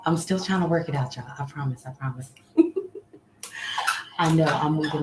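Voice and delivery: ordinary delivery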